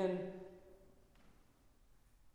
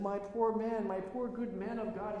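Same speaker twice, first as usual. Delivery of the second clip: higher, tender pity; tender comfort; 6 semitones higher than usual. Retold or imitated. Saint Macarius pitying the beaten weeping priest, tender and higher. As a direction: coo over him like a mother over a hurt child.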